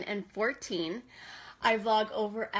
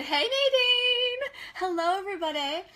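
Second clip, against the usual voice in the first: excited greeting; 8 semitones higher, 4 dB louder than usual. Real, not acted. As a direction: stretch out a happy hello. Greets arriving viewer.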